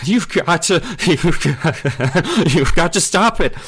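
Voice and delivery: high, loud, laughing plea; boy pleads mid-laughing fit; giggling plea. A narrator's reading. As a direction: beg him to stop through giggles. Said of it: "A high giggle burst out".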